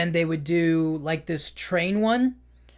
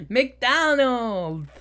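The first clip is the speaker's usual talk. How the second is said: stretched, higher McDonald's; put-on accent; 5 semitones higher than usual. The villain names his favorite food in a put-on accent. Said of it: "He's trying to do the, the accent".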